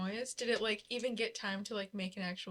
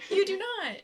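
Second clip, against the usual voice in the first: high and loud, slow insistence; loud bossy captain; 8 semitones higher, 6 dB louder, slower than usual. Acted. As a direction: insist loudly, over him. Racing rival in tunnel.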